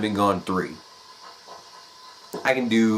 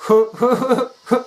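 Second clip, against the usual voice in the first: loud laugh; 5 dB louder than usual. Real, not acted. Punchline laugh.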